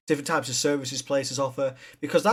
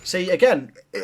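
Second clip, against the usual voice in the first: ordinary delivery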